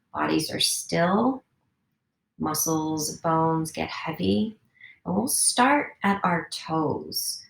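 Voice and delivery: guided meditation. Acted. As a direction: coax them to stillness, toe by toe. Guides a body scan.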